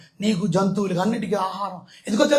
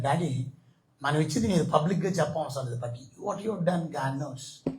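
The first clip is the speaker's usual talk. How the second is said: gentle words to dad; 4 semitones lower, 7 dB quieter than usual. Retold or imitated. Telling his dad gently.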